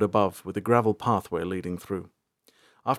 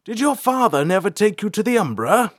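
loud, raised voice over the carriage; Bolthar shouting; 11 semitones higher, 6 dB louder than usual. A narrator's reading. Bolthar shouting to April from the carriage outside, loud. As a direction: call out from outside the speeding carriage. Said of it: "Bolthar shouted".

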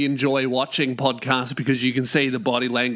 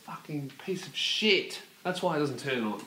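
muttered curse; 2 semitones lower, 10 dB quieter than usual. Real, not acted. Host grumbling at a fan that won't start, muttered. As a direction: grumble it quietly, away from the listeners.

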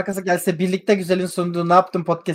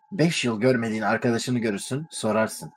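ad voiceover; 8 semitones lower, 7 dB quieter than usual. Pretend. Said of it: "şey gibi reklam böyle".

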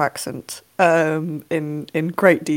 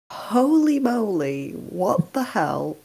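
amazed; 5 semitones higher, slower than usual. Real, not acted. Stunned by the film.

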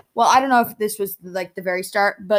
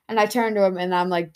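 usual speaking voice

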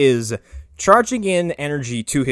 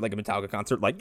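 ordinary delivery

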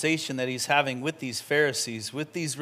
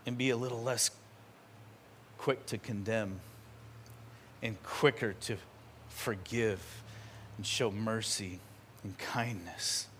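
quiet inner thought; 6 semitones lower, 11 dB quieter than usual. Pretend. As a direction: think it to yourself, humbled. Voices listener's inner realization.

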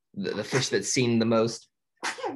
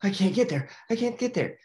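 higher, a mock anxious whine; worried Marlin; 9 semitones higher than usual. Marlin watching the mask fall, mock anxious. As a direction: fret it out in a rising panic. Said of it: "And Marlin's like".

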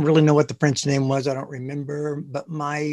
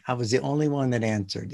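usual speaking voice

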